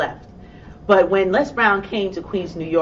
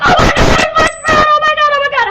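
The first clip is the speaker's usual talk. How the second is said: much higher, louder squealing scream of joy; excited screaming; 10 dB louder than usual. Retold or imitated. On seeing Les Brown's voicemail, screaming with joy. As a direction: scream it like you nearly crashed the car. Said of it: "waited for me to stop screaming".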